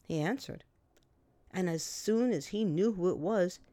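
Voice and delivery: ordinary delivery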